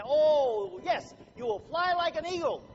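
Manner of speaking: loud, high, hammed-up inspirational gush; mock motivational speaker. Speaker mocking a motivational guru, high hammed-up gush. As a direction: parody a guru promising you'll soar. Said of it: "motivational speaker come to you and say".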